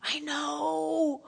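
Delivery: hushed, near-whispered apology; soft contrite prayer; remorseful hush. Retold or imitated. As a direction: breathe out a sorry confession. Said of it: "you're going".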